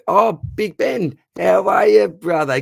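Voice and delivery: drawn-out, high, loud hello; excited greeting. Real, not acted. Host welcomes a favourite viewer, slow, high and loud. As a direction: stretch the name out, delighted.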